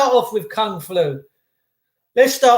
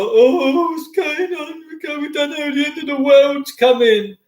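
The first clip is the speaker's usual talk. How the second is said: mock doom-monger; 6 semitones higher than usual. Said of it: "everyone's like".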